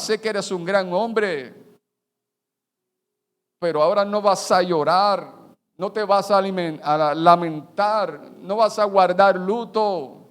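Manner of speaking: loud, forceful voice of God, slow at the end; God speaking sternly to the prophet; stern command. Acted. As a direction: proclaim it as God's firm order. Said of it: "le dice".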